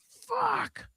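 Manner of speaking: a pinched mock scream of despair; retold scream. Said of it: "And I just scream like".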